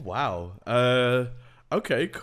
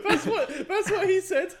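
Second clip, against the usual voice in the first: high, laughing punchline. Real, not acted. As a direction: giggle out the joke. Joke through laughter.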